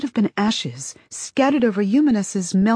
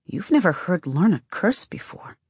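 ordinary delivery, nothing marked

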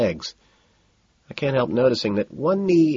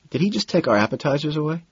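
baffled; 6 semitones higher, faster than usual. A narrator's reading. McDermott baffled.

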